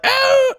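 high squeaky hinge noise; creaky hinge squeak. Shown as a demonstration. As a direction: squeal like a rusty robot hinge. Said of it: "poorly oiled robot hinge that goes".

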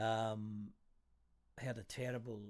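usual speaking voice

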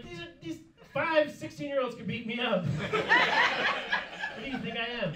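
high-pitched scared whine; whiny high protest. Retold or imitated. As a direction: plead in a high, frightened voice.

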